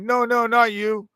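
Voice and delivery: hurried high correction, pushing not; retelling his own quick high protest; high, pleading denial. Retold or imitated. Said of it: "I'm like".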